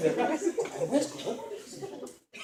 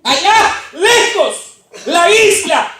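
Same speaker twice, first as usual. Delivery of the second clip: loud slow preaching; predicación; 10 semitones higher, 13 dB louder, much slower than usual. Acted. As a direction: proclaim it to the congregation. Sermon opening at full force. (predicación enérgica)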